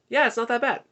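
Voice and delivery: high-pitched small-talk reply; Justin. Retold or imitated.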